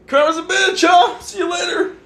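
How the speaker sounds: high, loud, laughing gloat at the landlord; gloating taunt. Retold or imitated. Retelling her parting shot to the roommates, loud gloating laugh. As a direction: rub it in their faces, cackling. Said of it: "I was like".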